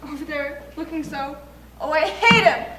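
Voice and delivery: bridal panic. Acted. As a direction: cry out in a rising panic. Bride panicking before wedding.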